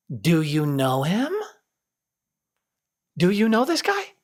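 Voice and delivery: put-on high, slow, sing-song drunk stranger rising to a squeal; mocking drunk. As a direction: tease like an obnoxious drunk, pitch climbing each line. Sink guy voiced, mocking narrator.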